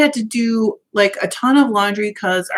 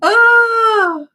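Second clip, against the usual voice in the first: sudden cry; 11 semitones higher, 6 dB louder than usual. Real, not acted. Sudden outburst during greetings.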